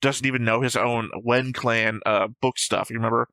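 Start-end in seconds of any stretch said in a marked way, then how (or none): none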